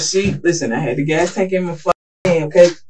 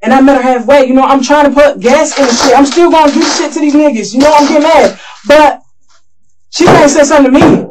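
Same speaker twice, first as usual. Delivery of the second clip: raised, higher, louder insistent protest; getting worked up; 5 semitones higher, 6 dB louder than usual. Real, not acted. Suspect to detective, denying she told him to go away, loud and heated. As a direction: push back hard, voice climbing and loud.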